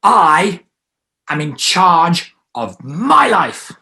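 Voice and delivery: slow, loud, high "I am in charge of my life"; stronger declaration. Shown as a demonstration. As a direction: proclaim it. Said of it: "it's gonna be stronger".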